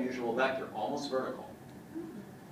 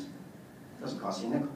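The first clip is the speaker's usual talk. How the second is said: soft punchline; 3 semitones lower, 7 dB quieter than usual. Real, not acted. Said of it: "punchline aside".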